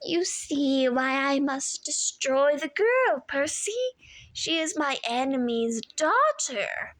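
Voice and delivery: slow purring menace, dropping to a whisper; comforting purr. A narrator's reading. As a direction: lull him with false comfort. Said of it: "modulated back to a comforting purr".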